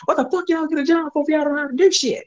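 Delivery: high put-on angry voice; mock country dude scolding a young worker; imitated cussing customer. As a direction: sneer it at the kid. Quoting dude cussing at kid.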